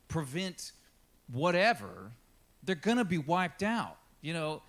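ordinary delivery

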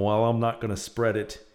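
usual speaking voice

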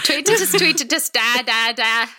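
shaky stab at Northern Irish, Scottish-sounding accent. Shown as a demonstration. Guest attempts third mystery accent after laughing, Northern Irish accent. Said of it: "attempting Northern Ireland".

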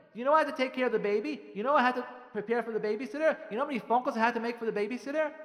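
defensive. Acted. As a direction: play the indignant wife piling up grievances. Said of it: "you get all defensive".

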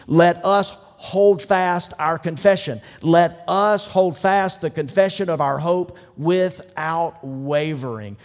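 loud, driving sermon cadence; full-force preaching. Acted. Pastor urging his congregation on, preaching loud. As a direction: hammer each let us home.

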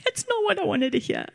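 mock dismay. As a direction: play up the alarm at bad news, half-joking. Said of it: "And I was like".

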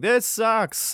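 high mock whine, pitch jumping up; whiny complaint. Israelites griping to Moses, high mock whine. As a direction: whine it like a sulky kid.

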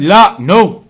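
loud, firm denial; emphatic no. Acted. Teacher voices the Prophet's denial, loud and firm. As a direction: cut the idea off with a firm loud No.

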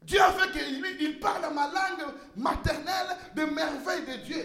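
ordinary talk, nothing marked

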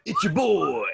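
high hype-man intro; stage name hype voice. Pretend. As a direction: announce yourself like a rapper. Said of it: "that's my stage name".

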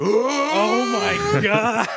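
high, drawn-out comic gasp of horror; shocked priest. Acted. Voicing the priest's look at the kiss, mock shock. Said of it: "The priest".

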